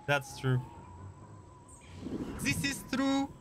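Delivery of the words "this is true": high-pitched put-on German accent; playful, squeaky mock German. Repeats agreement in mock accent.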